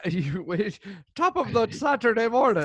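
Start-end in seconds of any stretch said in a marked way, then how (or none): 1.1-2.6 high Irish-style morning greeting; hammed-up Irish lilt; mock Irish accent. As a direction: send up an Irish brogue, pitched up. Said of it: "is that your Irish accent?".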